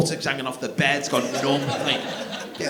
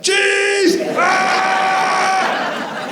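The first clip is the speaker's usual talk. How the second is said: high, loud shriek; shrieked cheese and scream; 7 dB louder than usual. Retold or imitated. Toddler jumping out at dad at the fridge, high loud shriek. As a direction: jump out and shriek.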